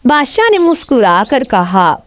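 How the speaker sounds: ordinary delivery, nothing marked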